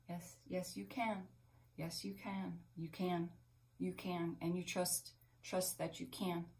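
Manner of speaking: softly reassure, almost a murmur; reassuring. Real, not acted. To a viewer afraid to give: soft, reassuring.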